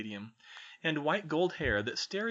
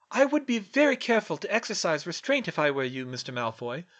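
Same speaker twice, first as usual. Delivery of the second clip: usual speaking voice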